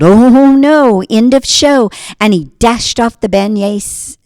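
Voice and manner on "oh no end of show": loud high alarmed cry; yelled. Acted. Beignet boy spots the hungry drummer, loud alarmed yell. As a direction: sound the alarm and bolt.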